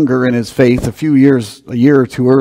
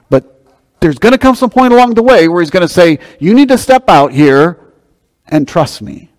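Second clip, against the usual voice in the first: higher and louder, building to the call; rising preaching fervor; 6 semitones higher, 5 dB louder than usual. Real, not acted. To the congregation, the preacher builds into a higher, louder appeal. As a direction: lift it up like a charge to step out.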